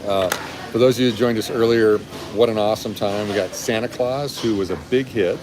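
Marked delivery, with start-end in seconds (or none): none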